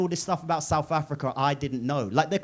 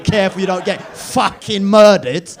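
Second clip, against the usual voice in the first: louder warning; 10 dB louder than usual. Retold or imitated. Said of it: "every single person to me went".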